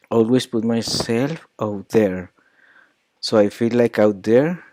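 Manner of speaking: "out there" más largo y alto; stress on out there. Shown as a demonstration. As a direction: alarga y sube "out there". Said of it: "there is more stress".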